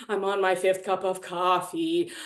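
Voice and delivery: hammed-up fatigue; dragging tiredness. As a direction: act worn out, dragging the words. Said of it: "like, dragging".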